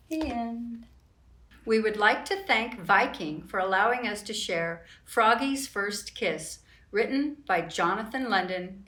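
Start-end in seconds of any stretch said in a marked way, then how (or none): none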